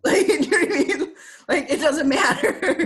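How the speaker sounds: laughing through the words; giggling while repeating it. Real, not acted. Laughing repeat of her point.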